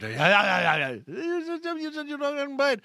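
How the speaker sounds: high, slow, put-out protest; hammed-up indignant Inspector Clouseau. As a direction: drag it out, wounded, in a put-on voice. Replays Clouseau complaint.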